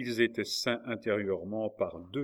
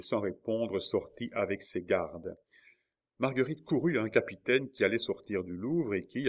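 plain reading voice

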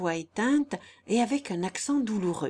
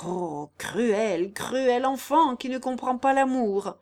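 impassioned lament, climbing and louder; anguished outcry; 4 semitones higher, 5 dB louder, slower than usual. A narrator's reading. Guillaume wringing his hands over Jeanne, anguished outcry. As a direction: cry out against her cruelty. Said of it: "s'écria Guillaume en se tordant les mains".

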